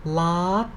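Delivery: lad climbing to a high end; rising lift on lad; high-tone demo. Shown as a demonstration. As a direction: glide lad steadily upward. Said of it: "it is the high tone".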